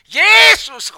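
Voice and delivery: the man finally yelling the name into the phone; shouted name. Retold or imitated. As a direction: blurt it out at the top of his lungs. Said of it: "Til sidst så råbte manden".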